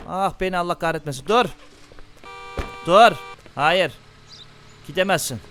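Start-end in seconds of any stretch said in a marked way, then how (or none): 1.3-5.4 louder commands building to a yell; rising angry shout; loud order. Real, not acted. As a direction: bark at the driver to get out. Ordering driver out of car.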